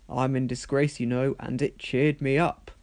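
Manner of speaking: ordinary delivery, nothing marked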